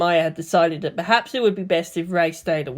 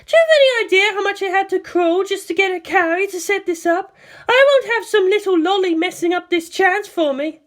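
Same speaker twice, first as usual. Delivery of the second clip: angry scolding; 13 semitones higher, 4 dB louder than usual. Acted. Angry sister scolding sibling.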